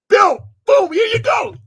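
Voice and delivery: loud and high, showing off the cash; proud shout to the mentor; triumphant shout. Retold or imitated. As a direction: slam it down like a winner. Proudly handing over the money.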